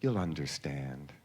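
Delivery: a lightly voiced, haughty brush-off; icy dismissal. Jackie brushes off autograph seekers, low and slow. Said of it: "she just said".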